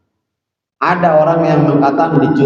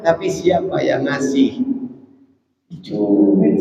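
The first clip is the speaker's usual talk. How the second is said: usual speaking voice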